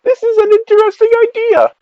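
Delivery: high, mock-enthusiastic self quote; mock excited inner voice. Imitating her own past excitement.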